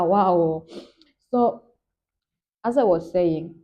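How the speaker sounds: normal talking voice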